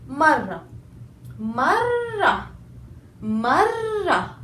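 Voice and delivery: Marra with a strong doubled r. Shown as a demonstration. Teacher drills shadda on Marra, stressed r. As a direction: press the rr in Marra. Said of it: "Okay, stress on the letter".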